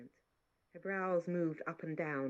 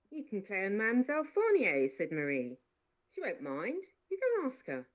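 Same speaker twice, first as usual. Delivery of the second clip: higher, louder maid; maid's voice; 5 semitones higher, 5 dB louder than usual. Acted. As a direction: coax her to go ask. Maid voicing advice.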